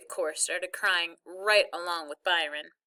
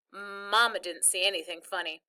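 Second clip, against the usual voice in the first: plain reading voice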